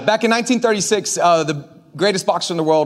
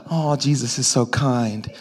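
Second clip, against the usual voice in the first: lower, softer, slow and gentle; mock-soft sweet voice; 7 semitones lower, 3 dB quieter, slower than usual. Mimicking what the church thinks Jesus says, soft and sweet. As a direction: coo it like a meek, gentle Jesus. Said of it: "Like, Talitha".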